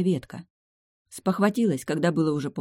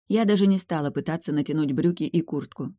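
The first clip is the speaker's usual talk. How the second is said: plain reading voice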